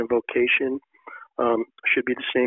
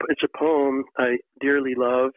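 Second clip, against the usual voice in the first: ordinary talk, nothing marked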